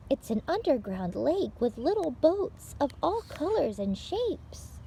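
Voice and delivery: bright, lively read-aloud for little listeners; picture-book reading. Acted. Librarian reading a picture book to children, lively read-aloud. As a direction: tell it like story time for small children.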